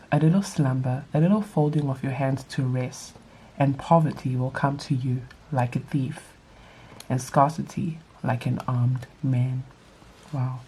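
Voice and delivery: ordinary talk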